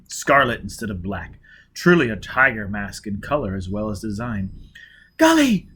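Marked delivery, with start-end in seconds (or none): 5.2-5.7 high, loud cry of shock; horrified cry. A narrator's reading. Gisbella crying out at Foyle's face, horrified. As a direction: cry out his name in horror. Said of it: "she cried".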